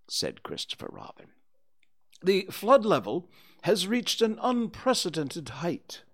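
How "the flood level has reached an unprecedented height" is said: high, loud owl character voice; lofty, raised storybook owl; pompous Owl. Acted. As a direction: pronounce it like a self-important owl. Owl figure making grand pronouncement.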